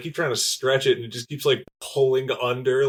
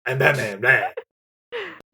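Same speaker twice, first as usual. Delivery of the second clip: Batman impression; much slower than usual. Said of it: "Michael Keaton going".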